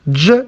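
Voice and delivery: J said as "juh"; J as an isolated sound, not the letter name; consonant sound demo. Shown as a demonstration. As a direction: sound out J, the sound in judge. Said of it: "as in judge".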